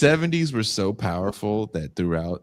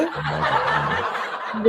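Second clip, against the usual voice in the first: laughing; much slower than usual. Real, not acted. Repeats laughing.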